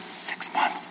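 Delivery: mock whisper leaned toward wife; quietly predict the breakup to your wife; whispered aside. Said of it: "I've leaned over to Lisa".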